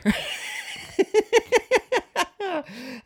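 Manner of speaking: high-pitched burst of laughter; laughing. Real, not acted. Host laughing at a listener's dinner story, high giggles. Reaction to the choked-on-dinner joke.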